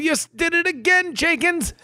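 high-pitched put-on lament; mock dismay. Reacting to the blacksmith's blunder, high mock dismay.

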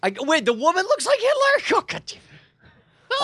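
very high, disbelieving yelp; exasperated cry breaking into laughter; incredulous outburst. Real, not acted. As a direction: blurt it out, appalled and amused. Player reacts to the absurd reveal.

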